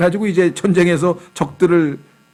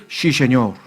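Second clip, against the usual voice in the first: put-on Sí said as 씨 in a Gyeongsang accent, lower; 4 semitones lower, much slower than usual. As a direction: Gyeongsang student saying 씨 세뇨. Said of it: "경상도 학생들은". (경상도 사투리 발음)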